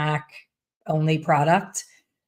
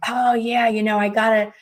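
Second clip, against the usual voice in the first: higher, sheepish excuse; sheepish client; 4 semitones higher than usual. Retold or imitated. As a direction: explain yourself a little embarrassed. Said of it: "you hear it in their voice".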